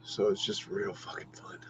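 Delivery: soft unvoiced whisper. Real, not acted. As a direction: hush it like a secret aside. Sly aside after ranting.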